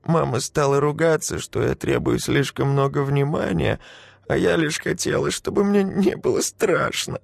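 put-on high tearful little girl; a frightened child telling. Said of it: "ответила девочка и заплакала".

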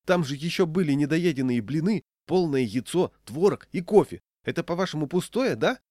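high, loud list of grievances; indignant shouting. A narrator's reading. Diner lists his lost food to the old woman, indignant shouting. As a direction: pile up the evidence, loudly outraged. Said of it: "закричал я".

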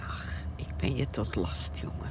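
whispered resignation. Acted. Old mother whispers to her son that she is a burden. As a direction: breathe it out as if giving up.

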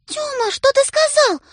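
very high, loud alarm; shocked sister. A narrator's reading. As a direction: rush in aghast. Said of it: "подлетает сестра".

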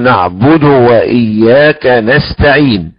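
"نعبد" with a full clear damma on the dal, chanted slowly; correct recitation. Shown as a demonstration. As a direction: recite "نعبد وإياك" joining with a clean short u. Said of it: "نقول". (ترتيل صحيح بضم الدال في "نعبد")

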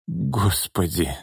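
slow, soft anguished moan. Acted. Lyudmila moans in disbelief, slow and soft. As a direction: groan it out, hardly audible. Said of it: "простонала Людмила".

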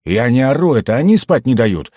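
high, loud, aggrieved; indignant self-justification; grumbling protest. A narrator's reading. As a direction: grumble back defensively. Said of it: "проворчал гном".

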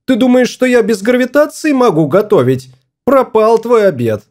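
high-pitched indignant outburst, little added volume; crying out angrily. A narrator's reading. Wife berating husband over the ruined dinner, crying out angrily. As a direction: scold him in a high, outraged voice. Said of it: "крикнула она".

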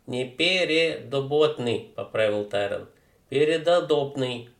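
put-on slangy street teen, loud and cocky; a brash teenage punk mangling words; дерзкий уличный парень. Street kid voice. (громкий развязный подросток)

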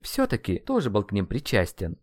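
usual speaking voice

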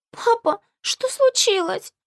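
put-on high, slow child's voice; a puzzled small girl asking; little girl voice. Little girl wakes, asks father.

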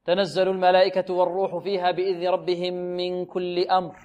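even recitation, "أمر" ending in voiceless breath; devoiced final r. Teacher imitates students' recitation, missing final r. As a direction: chant through, then lose the r of "أمر" in air. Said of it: "أين الراء؟".

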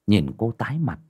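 ordinary delivery

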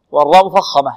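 ordinary delivery

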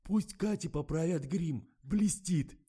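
high, slow nasal plea; timid, stuffed-up request; timid nasal whine. A narrator's reading. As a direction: whine it meekly through a blocked nose. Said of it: "робко прогундосил оператор".

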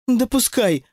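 lightly voiced indifference; offhand remark. A narrator's reading. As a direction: let it drop dismissively. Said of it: "уронила Алиса".

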